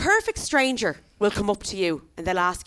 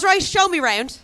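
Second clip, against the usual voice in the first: brisk cop; 10 semitones higher, 7 dB louder, slower than usual. Retold or imitated. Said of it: "as if this is a house viewing".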